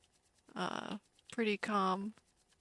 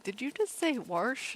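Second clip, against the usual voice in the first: retold teasing question; 5 semitones higher than usual. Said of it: "And I'll be like".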